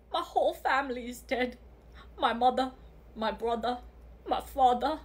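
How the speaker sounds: low, soft, halting grief act; mock-tragic. Girl gaslights mother about dead family, mock-tragic. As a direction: list each loss quietly with pauses.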